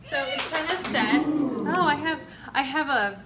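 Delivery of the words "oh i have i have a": ordinary talk, nothing marked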